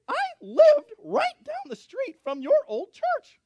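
very high, loud, drawn-out squeal; slow, piercing cartoon-high impression; excitable receptionist. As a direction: mimic a woman with a tiny high voice, cranked up. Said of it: "she talks like this all the time".

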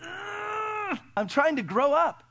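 put-on high strained, whining cry of a kid; little boy straining. Boy telling his mom he is growing up, strained. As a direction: groan it out like a boy pushing hard. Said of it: "And he goes".

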